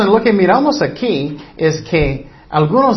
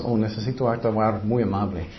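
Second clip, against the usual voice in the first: low, soft mock musing, breaking into a laugh; lightly acted inner voice, low and quiet; mock self-talk; 6 semitones lower, 8 dB quieter than usual. Preacher mimics how we think we must act kind, lightly mocking.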